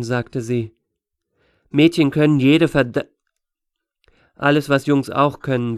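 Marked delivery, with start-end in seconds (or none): 1.7-5.8 louder start, mildly barked; a brusque retort that checks itself; barking. A narrator's reading. Deborah correcting Astor about girls, barking. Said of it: "blaffte Deborah".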